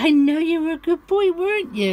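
high, sing-song praise for the dog. Real, not acted. Praising the dog after a walk.

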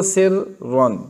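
सिर्रन split, first R thin and second heavy; thin then heavy R. Shown as a demonstration. Teacher shows why it is hard, सिर्रन split. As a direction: break सिर्रन into a light sir and a full ron. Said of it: "कि पहले को बारीक पढ़ो".

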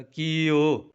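"ਕੀਓ" with long held ee and o; drawn-out vowel. Shown as a demonstration. Teacher demonstrating the long vowel, "ਕੀਓ" drawn out. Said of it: "ਲੰਮਾ ਕਰਕੇ ਬੋਲਿਆ ਜਾਵੇਗਾ".

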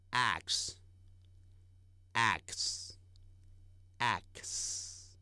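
axe whispered very slowly, wide a; drawn-out axe. Shown as a demonstration. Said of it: "斧头".

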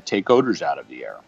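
ordinary delivery

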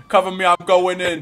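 loud action-hero call; cover me shout. Pretend. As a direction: shout it like storming in. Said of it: "You guys need to cover me".

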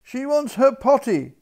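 high, loud frantic call; cook's loud high shout; crying out. A narrator's reading. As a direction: pass the alarm on at full voice. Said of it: "cried the cook".

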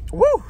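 high shrill woo from the heat; loud hot-sauce whoop. Real, not acted. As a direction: whoop at the burn. Reacting to hot wings.

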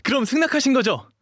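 very high, excited exclamations; overjoyed. Acted. Dog boy thanking his hyung for letting him stay, elated. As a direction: burst out with delighted gratitude.

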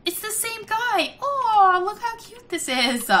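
high, delighted squeal of words; excited gushing. Real, not acted. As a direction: coo over it at a high pitch. Gushes at a cute sight.

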